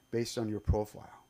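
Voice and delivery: normal talking voice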